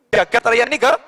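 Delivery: ordinary delivery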